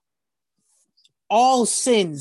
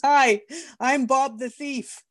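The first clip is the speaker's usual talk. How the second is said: slow, higher, cheeky greeting as a friendly thief; cheerful thief; 6 semitones higher, slower than usual. Acted. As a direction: introduce yourself like a grinning rogue. Thief introduces himself cheerfully.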